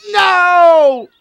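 loud dismayed no, pitched high; anguished no. Real, not acted. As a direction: cry out in despair. Item counted wrongly as bear.

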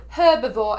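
herbivore with a clearly sounded h, British style; British pronunciation. Retold or imitated. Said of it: "as the British would say".

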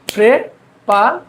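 প্রেপা very slowly in two beats; syllable-by-syllable pronunciation. Shown as a demonstration. Teacher asks students to look, প্রেপা slowly split. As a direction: pause between the syllables of প্রেপা. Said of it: "দেখেন".

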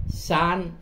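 san said as the Cantonese reading. Shown as a demonstration. Teacher showing the Cantonese reading san. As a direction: give san its Cantonese sound. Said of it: "người Quảng Đông thì đọc là".

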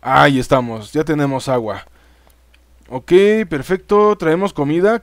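ordinary talk, nothing marked

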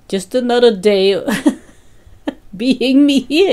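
mother laughing to her son at her own mishap; laughing at herself. Retold or imitated. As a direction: joke about yourself through a laugh. Joking to son about herself.

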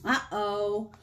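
plain reading voice

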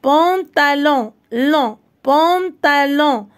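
"pantalon" very slowly drawn out; syllable by syllable. Shown as a demonstration. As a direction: stretch every syllable of "pantalon". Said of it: "كاينة في المقطع الأخير".

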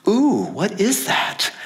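drawn-out, understated "ooh"; mock mild surprise. Preacher mocking a too-tame reading of the disciples, mild mock wonder. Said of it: "it's not like they were going".

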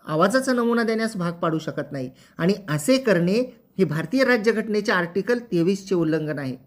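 normal talking voice

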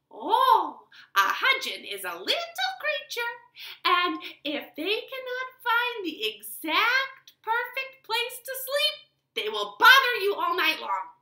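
put-on high, loud old-woman voice; wise woman voice. Wise woman explains the creature, high and loud. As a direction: make her a high, strange old crone explaining. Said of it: "said the woman".

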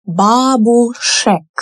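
бабушек said slowly, every sound clear; slow model word. Shown as a demonstration. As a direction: draw out бабушек for learners. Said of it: "we dropped the a".

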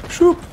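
mouth sound effect. Real, not acted. Sound effect while moving.

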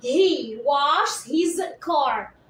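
very slow, word by word; slow dictation. Shown as a demonstration. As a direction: dictate slowly for copying. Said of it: "فأنا جيت حطيت له إي إس".

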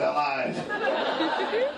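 very high, squeezed out through laughter; laughing retold punchline. Dad answering the child in a retold story, laughing. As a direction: deliver the imitated comeback while cracking up.

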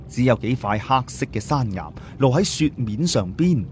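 ordinary delivery, nothing marked

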